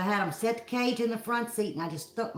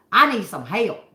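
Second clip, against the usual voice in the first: louder, pointed demand for help; 7 dB louder than usual. Retold or imitated. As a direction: make him hear you mean it. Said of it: "And I had a tone".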